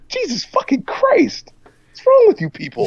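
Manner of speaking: high squeaky outraged voice; mock outrage. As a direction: squeal it in fake shock.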